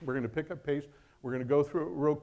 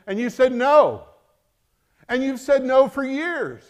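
louder, impassioned pulpit preaching; preaching at full force; 4 semitones higher, 8 dB louder than usual. Acted. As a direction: drive the rebuke home to the church. Preaching climax.

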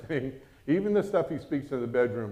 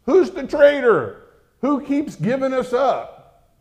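furious demand; 11 semitones higher, 9 dB louder than usual. Acted. Enraged king demanding his officers name the traitor, loud and high. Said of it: "he demanded of them".

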